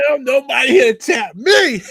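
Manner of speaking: loud, high, drawn-out boast; gloating shout. Retold or imitated. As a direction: crow it slowly at full volume. Gloating boast, retelling own reaction.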